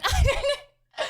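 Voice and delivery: high, slow giggle through the words; laughing. Real, not acted. Streamer cracking up at her own cave woman joke, laughing. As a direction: laugh it out helplessly.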